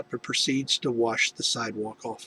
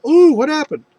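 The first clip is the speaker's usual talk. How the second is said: high, loud ooh; mock surprise; 12 semitones higher, 11 dB louder than usual. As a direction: gasp at the bloody face. Nurse reacting to injured face.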